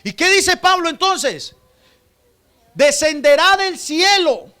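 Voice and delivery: loud, high, slow scripture proclamation; full-force preaching. Acted. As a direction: proclaim every word of the verse to the church. (proclamación lenta, alta y fuerte de la escritura)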